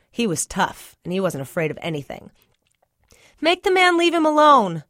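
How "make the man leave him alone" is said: high, loud call after him; hollering. Acted. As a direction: shout it after him across the distance. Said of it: "I hollered after him".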